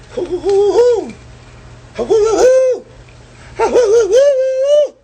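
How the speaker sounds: shrill excited owl-like hoots, loud; high hooting. Real, not acted. Streamer hooting at his own swagger, very high and loud. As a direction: whoop it up in falsetto.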